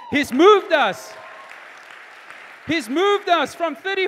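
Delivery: loud, high, triumphant testimony; full-force preaching. Real, not acted. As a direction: proclaim seven years of growth to the congregation.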